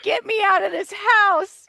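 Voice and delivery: high, loud, eager plea to escape; eager dad. Retold or imitated. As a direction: daughter imitating her dad, high and loud. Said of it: "he's like".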